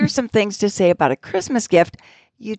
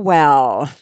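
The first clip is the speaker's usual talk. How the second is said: mock musing; 7 semitones lower, 4 dB louder than usual. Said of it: "This might be helpful".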